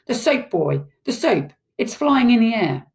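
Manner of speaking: a father's startled, urgent exclamation; amazed father. A narrator's reading. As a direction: exclaim in astonishment, pitch climbing. Amazed father sees floating soap.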